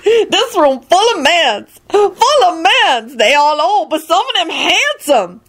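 high, drawn-out excited squeal; excited whoop. A narrator's reading. Woman thrilled by a room full of men, excited. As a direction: gush it high and slow with glee. Excited character shouting in story.

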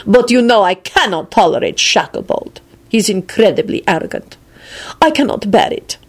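put-upon lordly disdain; scornful. A narrator's reading. Lord Radu complaining about arrogant Shacklebolt, scornful. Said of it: "he added scornfully".

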